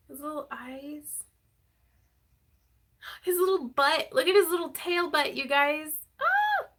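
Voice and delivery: high gushing over tiny details; overwhelmed by cuteness. Real, not acted. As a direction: coo at the little tail, high and bright. Gushing over felted chick.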